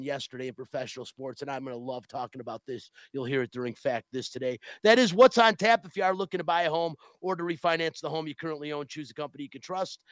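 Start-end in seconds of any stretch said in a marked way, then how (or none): 4.8-5.8 loud, high title call; shouted sign-off. Acted. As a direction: sign off the segment big. Host shouts segment close.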